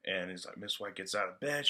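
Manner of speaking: ordinary delivery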